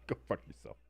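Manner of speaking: breathy whispered go fuck yourself; whispered teasing insult to a chatter. Real, not acted. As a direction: whisper it like a playful jab.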